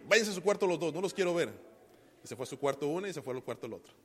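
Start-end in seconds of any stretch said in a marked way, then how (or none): 0.1-1.5 a louder, sharper parent scold; mock angry scolding. Father retelling how he scolded his fighting children, mildly angry. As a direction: send the kids to their room, a bit loud. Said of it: "me enojé y los regañé".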